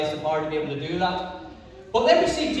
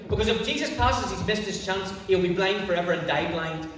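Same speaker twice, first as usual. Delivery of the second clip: usual speaking voice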